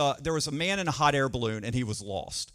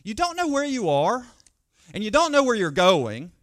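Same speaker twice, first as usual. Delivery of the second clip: raised, louder, pointed comeback; a woman telling off a manager, louder and sharper; pointed retort; 4 semitones higher, 4 dB louder than usual. Retold or imitated. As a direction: let her tell him off firmly, voice lifted. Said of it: "And she says to him".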